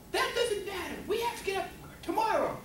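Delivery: high-pitched mock-mom scolding; scolding mother. Mother cuts off son's excuse, scolding high. Mother's side of retold quarrel.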